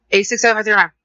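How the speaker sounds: imitating a customer talking too fast; rapid-fire customer. Customer giving the agent a phone number, rapid-fire. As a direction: rattle the digits off in one quick burst. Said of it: "they talk faster than me".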